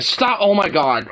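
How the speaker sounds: sudden high yelp; sharp yell. Real, not acted. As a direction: snap out a loud stop. Dog interrupts him.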